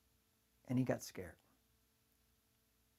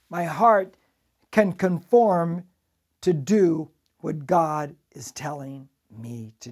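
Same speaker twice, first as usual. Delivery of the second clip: preaching; 6 dB louder, much slower than usual. Acted. Preaching build, slow emphatic.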